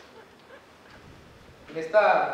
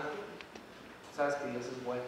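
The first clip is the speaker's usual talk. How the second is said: slow, softer and lower, sincere; tender appeal; 6 semitones lower, 9 dB quieter, much slower than usual. Real, not acted. Preacher reassures a doubter that God is good, soft and slow. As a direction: drop softly and slowly, reassuring.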